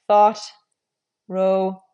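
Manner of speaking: "thought" loud, vowel between ah and uh; demonstrating the in-between vowel. Shown as a demonstration. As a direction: punch the example words. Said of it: "between the ah and the uh sounds".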